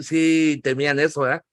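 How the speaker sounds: ordinary talk, nothing marked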